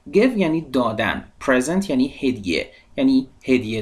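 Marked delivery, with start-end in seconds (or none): none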